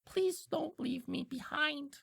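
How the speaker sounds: slow, high-pitched imp plea; small, high, pleading imp; Diplin pleading. Acted. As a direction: beg slowly in a small high creature voice. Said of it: "stops the cart and goes".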